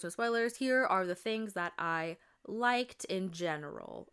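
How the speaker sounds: ordinary delivery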